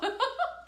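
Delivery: delighted laugh at a funny comment; high delighted laugh. Real, not acted. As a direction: burst out laughing.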